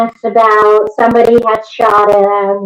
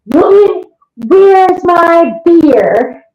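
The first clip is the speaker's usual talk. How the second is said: raised, drawn-out demanding holler, much higher; yelling possessed man; 7 semitones higher, much slower than usual. Retold or imitated. Possessed man demanding his beer from his woman, yelling. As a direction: holler it slowly like an overbearing husband. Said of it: "yelling out all this stuff".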